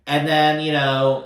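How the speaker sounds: ordinary delivery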